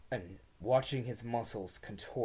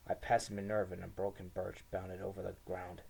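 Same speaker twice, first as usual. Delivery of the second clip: plain reading voice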